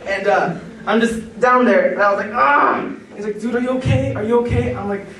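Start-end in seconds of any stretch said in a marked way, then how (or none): 2.3-2.8 short high yelp of pain. Retold or imitated. Slamming into the brick wall, yelping in pain. 3.4-4.7 higher, urgent are you okay; worried check. Retold or imitated. Friend rushing to check on him after the fall.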